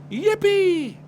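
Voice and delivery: high, loud yippee; cheer. Acted. The whole household setting off, high loud cheer. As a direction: whoop with joy. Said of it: "everyone cheered".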